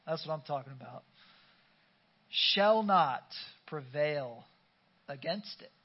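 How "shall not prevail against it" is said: very slow, each word spaced out; slow weighty declaration; drawn-out emphasis. Real, not acted. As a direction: stretch Shall not prevail out slowly. Preacher drawing out the promise.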